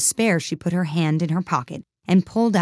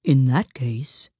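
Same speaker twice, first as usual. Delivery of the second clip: slow, grave dodo voice; solemn dodo; 4 semitones lower, much slower than usual. A narrator's reading. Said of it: "said the dodo solemnly".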